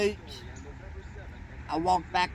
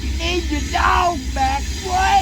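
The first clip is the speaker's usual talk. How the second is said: much higher and louder, outraged disbelief; incredulous outburst; 10 semitones higher, 7 dB louder than usual. Retold or imitated. Telling the dog's old owner he's crazy, incredulous yelling. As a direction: yell it like he can't believe the nerve.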